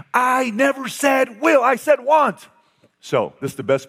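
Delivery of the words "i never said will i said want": shouted denial. Retold or imitated.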